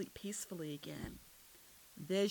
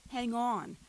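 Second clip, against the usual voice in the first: raised, higher-pitched call to brace; loud driver's warning; urgent warning; 5 semitones higher, 7 dB louder than usual. A narrator's reading. Driver warns before rough ride.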